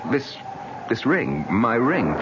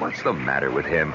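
ordinary talk, nothing marked

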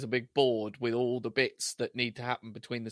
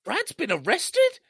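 high, slow, loud disbelief; hammed-up shock; 8 dB louder, slower than usual. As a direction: stretch it out aghast. Acting David's shock.

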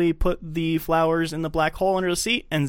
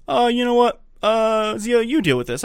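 loud, higher mock angry outburst; angry big guy; 9 semitones higher, 4 dB louder than usual. Big angry guy yells at the driver over the broken pump, mock angry.

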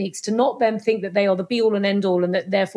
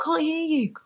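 high, mock muffled reply; muffled crowd; 6 semitones higher, 3 dB quieter than usual. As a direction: pretend to be sealed in a bubble. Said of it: "And they're like".

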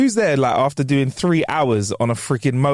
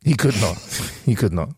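normal talking voice

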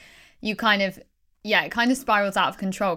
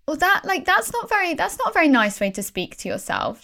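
put-on higher, gentle voice for a small child; talking to a child; 5 semitones higher than usual. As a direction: coax your inner child kindly. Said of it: "like I'm a child".

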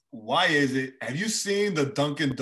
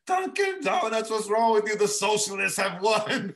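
mock outrage; 9 semitones higher, 3 dB louder than usual. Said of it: "I was like".

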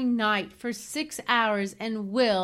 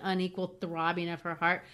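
usual speaking voice

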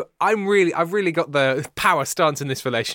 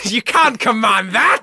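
high, slow shout; mock outrage; 6 semitones higher, 6 dB louder than usual. Host objecting to a co-host's command, slow mock outrage.

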